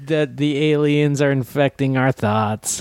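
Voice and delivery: mock-earnest DeLong-style apology; DeLong impression. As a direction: stretch the opening word, hammed up. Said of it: "There is some DeLong there".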